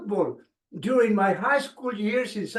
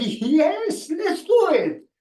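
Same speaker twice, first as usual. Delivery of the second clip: higher, louder eager wife's yes; 8 semitones higher, 5 dB louder, slower than usual. Retold or imitated. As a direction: voice the wife's keen agreement, pitched up. Wife agreeing to move.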